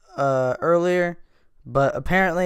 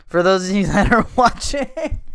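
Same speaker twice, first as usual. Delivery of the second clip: higher, laughing through the words; 6 semitones higher, 3 dB louder than usual. Real, not acted. Host describing the carjacker clip for listeners, laughing. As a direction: break into a laugh mid-sentence.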